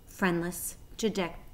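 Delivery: plain reading voice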